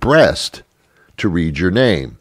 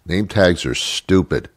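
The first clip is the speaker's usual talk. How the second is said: ordinary talk, nothing marked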